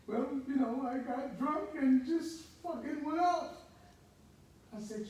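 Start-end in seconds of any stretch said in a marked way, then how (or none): 0.1-3.6 lightly voiced jailmate, higher pitched; retold jailmate's confession. Big guy in jail explaining his arrest, lightly acted higher voice. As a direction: raise the pitch for the other guy's account.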